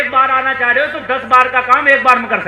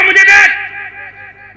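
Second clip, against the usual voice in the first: very slow, higher, louder shout; drawn-out shout; 10 semitones higher, 6 dB louder, much slower than usual. Acted. Preacher challenges the rich man, drawn-out shout. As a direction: stretch it into a roar. Preacher voicing defiant stand.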